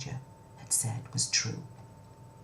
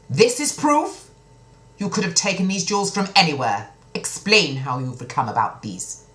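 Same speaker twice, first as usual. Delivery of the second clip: high, loud, scoffing disbelief; scornful king; 4 semitones higher, 8 dB louder than usual. Acted. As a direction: sneer at the flimsy proof.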